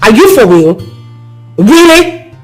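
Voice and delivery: scornful outburst at him; angry reproach. Acted. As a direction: lash out in disbelief. Woman rounds on the man.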